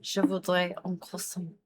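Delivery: attempted French accent, ordering politely. Shown as a demonstration. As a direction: order in your best, imperfect French accent. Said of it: "Still not French enough to be French".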